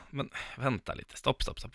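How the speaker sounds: soft, almost whispered stop; calm him down under her breath; hushed calming. Retold or imitated. Hon lugnar chauffören. (lugnande viskning)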